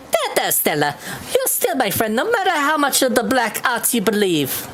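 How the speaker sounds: chirpy and upbeat; cheerful girl voice. Acted. Voicing Rosa, happy girl.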